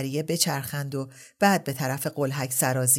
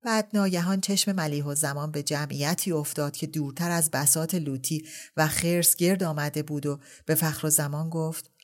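ordinary delivery

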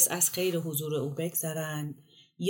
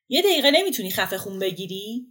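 loud, higher-pitched exasperated telling-off; angry scolding; 5 semitones higher, 10 dB louder than usual. Acted. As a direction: act out fed up, raising the voice at a friend. Host snaps at friend after woman leaves. (سرزنش عصبانی با صدای بلند)

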